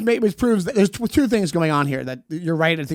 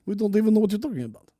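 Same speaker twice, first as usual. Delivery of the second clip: put-on Russian accent; 3 semitones higher, 3 dB quieter, faster than usual. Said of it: "That's my Russian accent".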